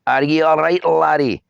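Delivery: mock Scottish accent, high and loud, rolled Rs. A narrator's reading. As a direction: tease him in a hammed-up Scottish accent. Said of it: "rolling his R's in mock Scottish accent".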